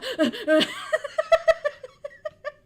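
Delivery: high, loud giggle fit; high laugh. Real, not acted. Laughing at her own answer.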